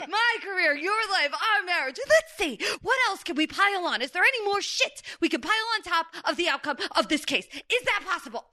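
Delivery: high-pitched, escalating lawyer's rant; worked-up, sarcastic movie tirade; exasperated Vinny. Acted. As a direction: pile it on, utterly fed up. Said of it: "I'm Vinny".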